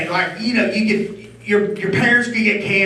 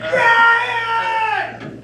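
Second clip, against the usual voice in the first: high mock yell of a wife calling from upstairs; shouting a name. Wife yelling name again.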